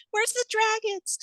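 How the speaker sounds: teasing impression of fans asking for dragons; mock fan voice. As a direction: squeak it out like a baffled fan. Fans' emails quoted mockingly.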